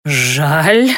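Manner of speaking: low hissed question. A narrator's reading. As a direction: hiss it back in fury. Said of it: "прошипел Дейморец".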